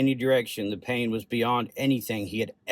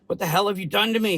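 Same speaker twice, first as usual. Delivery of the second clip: fast, high-pitched outburst; angry growl; 10 semitones higher, 4 dB louder, faster than usual. A narrator's reading. Confused patient confronts hazmat crew, growling angrily. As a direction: growl it as a furious demand. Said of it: "he growled".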